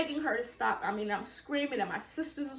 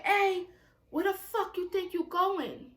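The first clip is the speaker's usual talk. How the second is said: mock angry yell; 7 semitones higher, 4 dB louder than usual. Mother yelling from the door.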